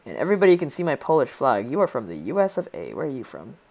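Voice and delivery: normal talking voice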